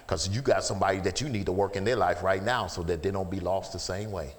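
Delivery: ordinary delivery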